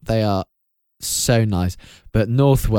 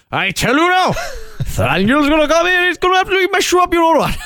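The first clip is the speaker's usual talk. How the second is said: hammed-up North Welsh with little laughs; North Welsh Bard; faster than usual. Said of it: "He doesn't say it like that".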